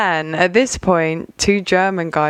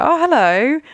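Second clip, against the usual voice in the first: fake casual greeting; 9 semitones higher than usual. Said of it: "act normal still".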